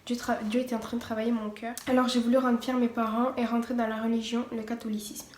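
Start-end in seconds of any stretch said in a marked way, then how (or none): none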